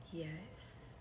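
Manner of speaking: breathless whispered yes; a tiny voiceless yes. Retold or imitated. Answering are you sitting down.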